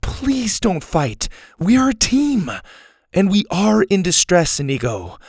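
high earnest plea; girl calming a quarrel; pleading girl. A narrator's reading. Susie calms them.

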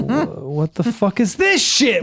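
rising angry outburst, shouting at the end. Retold or imitated. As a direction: blow up over the bills. Angry rant at spouse's bills.

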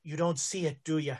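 soft, low, sorrowful question; a hint of pity, quietly; almost sadly. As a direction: priest gently telling Jared he misses the truth, sadly. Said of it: "almost sadly".

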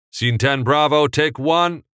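loud high slate call. Acted. Second assistant director calling the slate, loud. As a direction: announce the take crisply.

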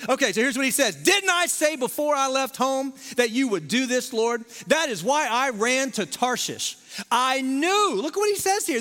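excited pulpit proclamation; full-force preaching. Acted. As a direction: fire up the congregation.

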